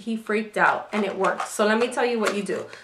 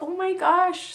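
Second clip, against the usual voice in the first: higher, amazed exclamation; 8 semitones higher, slower than usual. Retold or imitated. Mother marvelling at her toddler eating, higher amazed. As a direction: marvel at how much he eats. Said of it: "and I'm like".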